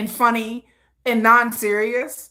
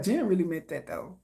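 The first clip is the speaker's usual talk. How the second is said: knowing low aside; 5 semitones lower, 9 dB quieter than usual. Retold or imitated. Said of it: "I'll be like".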